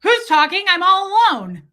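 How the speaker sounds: a startled little creature calling out; panicked squeaky character; voicing a lonely boob. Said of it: "this one's like".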